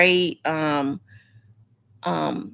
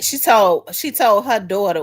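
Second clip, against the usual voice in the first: ordinary delivery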